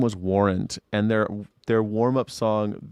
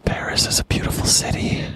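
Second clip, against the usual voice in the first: whisper. Real, not acted. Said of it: "whispered repeat".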